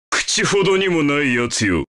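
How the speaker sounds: low-pitched contempt for a beaten foe; low, dismissive battle taunt; scornful taunt. Acted. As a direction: sneer it low, like a victor.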